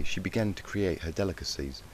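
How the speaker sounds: ordinary delivery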